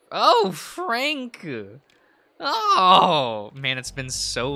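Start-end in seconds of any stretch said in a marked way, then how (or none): 0.1-1.5 high, loud delighted call; high excited cry. Acted. Character cries out a name. 2.4-3.5 surprised cry. Acted. Startled cry.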